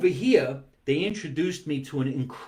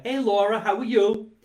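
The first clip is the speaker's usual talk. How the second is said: cheerful hello; 6 semitones higher, 4 dB louder than usual. Real, not acted. Calling out greeting to fan.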